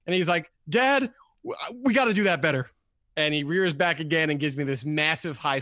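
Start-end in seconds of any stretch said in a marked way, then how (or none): 0.7-2.7 higher, imitated seven-year-old; excited little son. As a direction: pitch it up like an earnest kid. Son's line voiced in a story.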